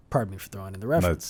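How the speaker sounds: rattled off fast as a sheepish aside; quick aside. Real, not acted. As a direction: rush the apology, half-laughing it away.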